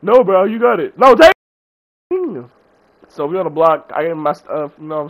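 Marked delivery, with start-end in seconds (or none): 0.0-2.5 higher, louder back-and-forth insisting; playful insisting. Retold or imitated. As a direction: push the gift back, getting louder. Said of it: "I was like".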